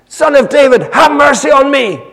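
high, desperate yell for mercy; top-of-voice shout. Acted. Blind beggar calling to passing Jesus, shouting. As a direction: shout it out at full voice. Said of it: "He shouts out, top of his voice".

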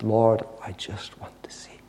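low, breathy near-whisper; a hushed plea weighted with years of misery; heartfelt longing. Acted. Blind man to Jesus after years of misery, whispered longing. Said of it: "all the longing of years of misery".

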